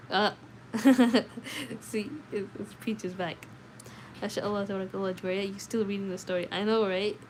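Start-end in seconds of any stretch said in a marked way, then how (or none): 0.4-1.7 a short real laugh at the chat; burst of laughter. Real, not acted. Streamer laughing at a viewer's chat comment, real laugh.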